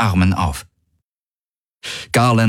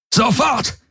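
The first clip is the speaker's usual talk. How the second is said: much higher thundered command; thundering. A narrator's reading. Soldier thunders at the captive admiral, furious. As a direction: blast the word like thunder. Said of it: "donnerte er".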